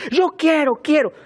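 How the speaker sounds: high-pitched mock child demand; demanding little girl. Pastor imitates the crying girl wanting things, high and demanding. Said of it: "Como aquella niña".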